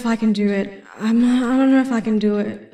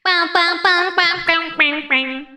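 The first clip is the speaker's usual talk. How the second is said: mock drum sounds; 4 semitones higher, 5 dB quieter than usual. Real, not acted. Hums the song's beat.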